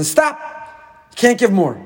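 high, sudden cry of stop; acted halting order. Telling the rich person to stop giving, as a high halting order.

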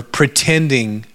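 usual speaking voice

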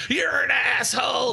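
high, stretched heckler holler; mock angry yell. Refused fan insulting the shooter, mock angry yell. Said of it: "screaming and yelling at me".

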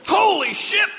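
very high, loud mock shout; shouted exclamation. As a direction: holler it like a startled son from downstairs. Repeats son's shout from downstairs.

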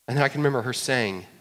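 ordinary talk, nothing marked

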